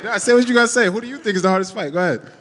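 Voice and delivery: high, fast, playful needling; high-pitched teasing challenge; excited teasing. Real, not acted. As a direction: egg him on to answer, grinning. Teasing the reporter to answer.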